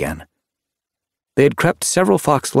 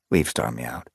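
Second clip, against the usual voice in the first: usual speaking voice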